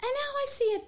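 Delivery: ordinary delivery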